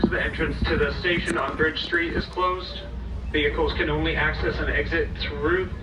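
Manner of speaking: normal talking voice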